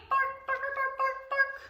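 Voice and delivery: high, yappy barking words; a hint of a barking dog; dog barks. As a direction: bark like a puppy for the kids. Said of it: "what noise do dogs make".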